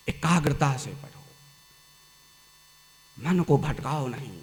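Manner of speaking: ordinary talk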